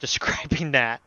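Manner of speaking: ordinary delivery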